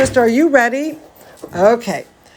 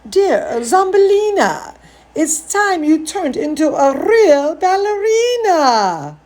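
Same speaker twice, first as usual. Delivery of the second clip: high, sing-song mom; sweet mother voice; 8 semitones higher than usual. Acted. Mother calls to Zambolina, high and sweet. Mother character speaks in book.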